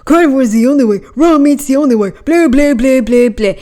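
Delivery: mock carnivore zealot. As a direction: lightly mimic a preachy carnivore. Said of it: "I also hate it on the other end".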